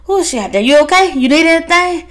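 a fretting brother checking in, voice up high; hammed-up anxious brother rushing his words; worried brother. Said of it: "the brother's like".

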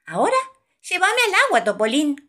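high, loud demand; fish shouting. A narrator's reading. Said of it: "grita el pez". (grito agudo de pez)

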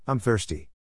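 ordinary delivery

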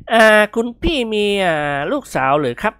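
bashful question. Acted. Shy friend asking the lady about daughters, bashful. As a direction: ask coyly, with little ums. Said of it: "กิมหนวนยิ้มอายๆ".

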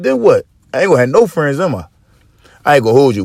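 ordinary talk

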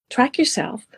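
usual speaking voice

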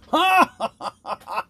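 high, loud burst of acted laughter, tailing off; roaring laugh. A narrator's reading. Tyrant laughs at the drinking dwarf, roaring laugh. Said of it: "roared the latter".

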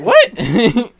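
loud, high, rising yelp; shocked disbelief. Retold or imitated. Son reacts to being called racist.

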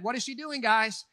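usual speaking voice

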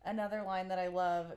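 ordinary talk

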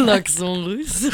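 slow, low mock Russian accent; attempted Russian accent. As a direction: try a Russian accent through laughter. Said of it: "tu vas avoir un accent russe maintenant".

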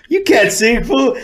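squeaky, high disbelief; high disbelieving exclamation. Retold or imitated. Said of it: "I go".

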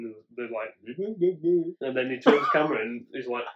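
ordinary talk

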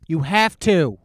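loud, slow insistent demand. Retold or imitated. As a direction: demand it at full volume. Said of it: "I'm like".

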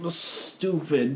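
usual speaking voice

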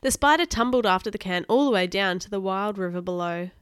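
ordinary delivery

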